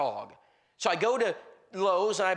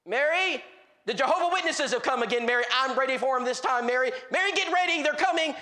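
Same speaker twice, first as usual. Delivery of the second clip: high, urgent calling to his wife; mock agitated yell; stirred-up husband; 5 semitones higher, 3 dB louder than usual. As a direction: imitate a man hollering a warning through the house. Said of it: "he is stirred up".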